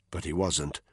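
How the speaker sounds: plain reading voice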